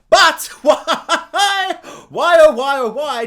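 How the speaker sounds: loud, high cry breaking into laughter; hammed-up despairing plea, belted out; mock exasperated wail. Nobody references the giant baby celestial, mock anguished shout.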